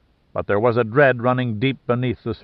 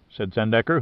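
usual speaking voice

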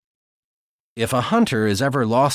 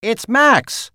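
higher, louder cry of recognition; excited cry; 11 semitones higher, 6 dB louder than usual. Acted. Holly spotting Max hauled from the vent, crying out. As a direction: call it out the moment you spot him. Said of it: "Holly cried".

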